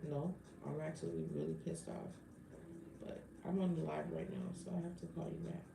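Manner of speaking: soft, hushed words into the phone; quiet phone aside. Real, not acted. Telling a caller she's on the live, hushed. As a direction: step away and murmur to the caller.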